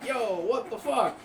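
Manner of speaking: high, slow, drawn-out disbelief; stunned reaction. Retold or imitated. Whole shop reacts to the screaming man, stunned. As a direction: imitate coworkers reeling in shock.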